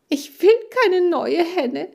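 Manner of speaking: high, loud tearful wail; bursting into tears. Acted. Laura refusing a replacement hen, breaking into tears. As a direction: break down crying on WILL. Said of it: "Laura brach wieder in Tränen aus".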